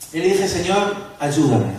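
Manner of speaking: ordinary delivery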